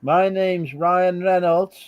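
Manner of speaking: hammed-up American accent, slow and loud at the start; mock American accent. Pretending to be Ryan Reynolds in the pubs, in a mock American accent. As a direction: put on a bad American accent, like an actor in a pub. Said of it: "I do my American accent".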